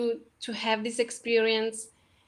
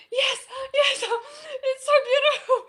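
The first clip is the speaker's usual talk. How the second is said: much higher, laughing and crying at once; laughing through tears; 12 semitones higher than usual. Retold or imitated. Reassuring her partner while laughing and crying. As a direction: let the joy spill into laughter and tears. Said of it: "And I said".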